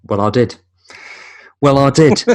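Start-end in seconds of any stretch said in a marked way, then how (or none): 1.6-2.2 louder, higher cocky retort; smug Jay impression. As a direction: say it like a smug teenager. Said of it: "And he was like".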